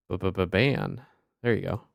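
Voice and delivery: normal talking voice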